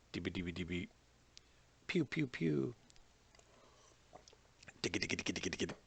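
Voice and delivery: mouth-made pew-pew blasts and rattles; explosion sounds. Shown as a demonstration. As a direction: make silly gun and explosion noises with your mouth. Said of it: "I can start making explosion sounds".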